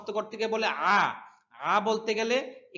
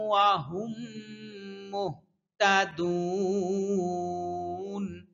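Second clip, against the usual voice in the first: slow, sung tajweed chant with long held endings; Quran recitation; 2 semitones higher, 4 dB louder, much slower than usual. Shown as a demonstration. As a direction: intone the verse like a reciter, stretching the last word. Teacher recites verse for students.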